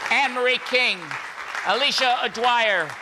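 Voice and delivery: ordinary talk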